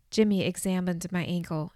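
plain reading voice

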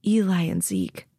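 usual speaking voice

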